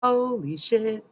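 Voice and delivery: shocked as it dawns on her, drawn out; sudden realization. Retold or imitated. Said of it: "I stopped and was like".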